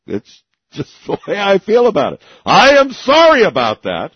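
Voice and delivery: laughing apology, high and loud. Real, not acted. Host laughs at own joke.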